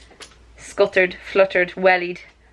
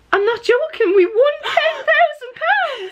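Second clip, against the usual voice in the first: higher, louder laughing shout; ecstatic shout; 4 dB louder than usual. Real, not acted. Friend shouting that they won the prize, ecstatic. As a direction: scream the news in delight. Thinks she won big.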